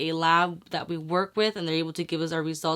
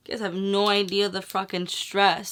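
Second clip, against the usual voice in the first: usual speaking voice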